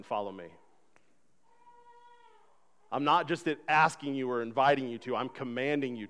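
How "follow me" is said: lower, softer "Follow me."; soft command. Acted. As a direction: say "Follow me." low and hushed. God's command, said softly.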